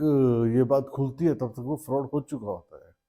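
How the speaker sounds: usual speaking voice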